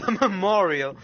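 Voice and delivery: streamer laughing at the game character's joke, loud, high and amused; amused echo. Real, not acted. Streamer echoing the memorial joke, loudly amused. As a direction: repeat it through a burst of laughter.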